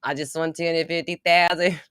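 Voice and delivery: amused mimicry of an Antipodean accent; imitated lottery winner's accent. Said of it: "I love that accent".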